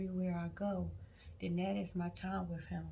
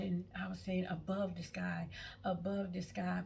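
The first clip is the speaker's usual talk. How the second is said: normal talking voice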